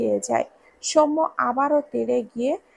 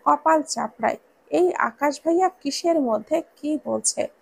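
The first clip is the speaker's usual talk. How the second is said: plain reading voice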